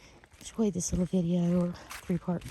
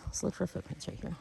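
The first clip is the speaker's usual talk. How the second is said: quiet; 13 dB quieter than usual. Real, not acted. Quietly searching for footprints.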